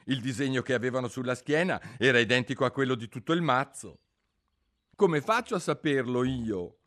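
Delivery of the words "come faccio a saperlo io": high, loud comeback to the queen; bold retort. A narrator's reading. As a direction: answer back with sudden courage. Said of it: "sorpresa del suo stesso coraggio". (risposta coraggiosa)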